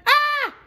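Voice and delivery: a high little squeaky scream; tiny mouse shriek. Acted. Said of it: "He's going".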